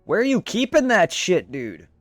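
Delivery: ordinary talk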